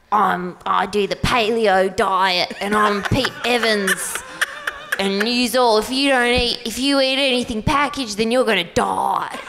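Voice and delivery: a hint of broad Australian, mocking; Pete Evans accent. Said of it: "Can you do his accent?".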